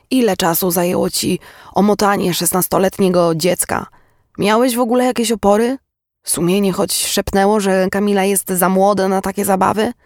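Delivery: scornful interrogation. Acted. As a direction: pile up accusing questions, pitch climbing. Mother's scornful interrogation of the man.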